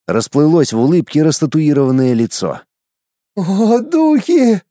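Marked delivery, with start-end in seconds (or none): 3.4-4.6 frightened cry. Acted. Mother cries out.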